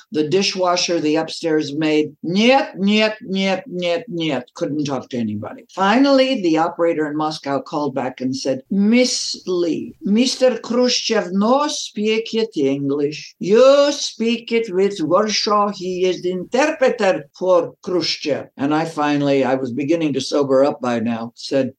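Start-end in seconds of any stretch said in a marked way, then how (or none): 2.2-4.4 Russian refusal. Retold or imitated. Embassy staff refusing her calls. 8.7-18.5 put-on higher, slow Russian-accented broken English. Moscow operator tells Miss Lee to speak with Warsaw, Russian accent.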